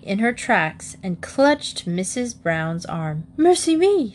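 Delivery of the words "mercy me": high-pitched shocked cry; alarmed exclamation. A narrator's reading. Missus Bird stopping suddenly in her tracks, alarmed. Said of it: "she exclaimed".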